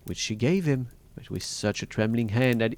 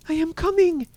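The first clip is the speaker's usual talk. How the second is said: very high, loud call; frantic reply; 8 dB louder than usual. A narrator's reading. Wife answering Bluebeard downstairs, frantic high call. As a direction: call back in high-pitched panic.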